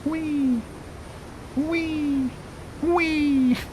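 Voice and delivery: high, delighted squeals; gleeful whee. Real, not acted. Plane bouncing along.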